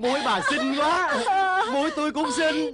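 delighted praise. Acted. Noses restored, delighted.

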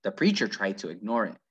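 ordinary delivery

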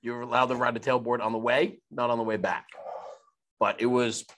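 ordinary talk, nothing marked

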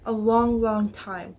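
ordinary talk, nothing marked

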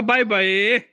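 high sing-song goodbye, last word stretched; baby-talk prompting. Shown as a demonstration. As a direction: model a long cheery farewell for a toddler. Models goodbye for toddler to copy. (ਬੱਚੇ ਲਈ ਲਮਕਾ ਕੇ ਅਲਵਿਦਾ)

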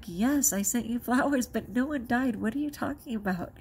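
husband voiced. Husband voiced in retold call.

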